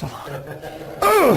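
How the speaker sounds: high-pitched grunt imitating a failed prayer; imitated strained grunt. Preacher imitating a husband who barely got his prayer out, strained grunt. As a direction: squeeze out a mock choked grunt. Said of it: "barely got anything out of his mouth".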